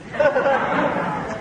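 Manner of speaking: high bursting laugh; real laugh at own joke. Real, not acted. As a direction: laugh out at the punchline.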